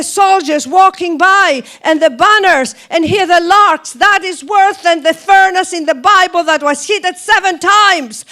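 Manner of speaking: loud, high, impassioned stage declamation; dramatic Joan of Arc monologue. Acted. Actress performing Joan of Arc on the bus, impassioned outcry. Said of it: "Would you like me to do it for you?".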